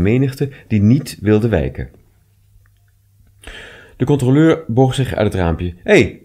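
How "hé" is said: high, loud shout to a crowd; raised voice ordering people aside; called out. A narrator's reading. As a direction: call out sharply to clear the way. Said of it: "riep hij".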